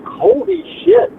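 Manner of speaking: way up high, slow, floored; stunned nephews. Retold or imitated. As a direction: drag it out in shock. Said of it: "they're like".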